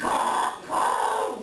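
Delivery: a raven's sarcastic double caw. Acted. Raven caws in mock agreement.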